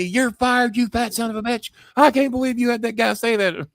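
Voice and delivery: high-pitched yelled tirade, retold; high, strained mock scream. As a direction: imitate an irate boss yelling in a high voice. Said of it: "yelling and screaming at me".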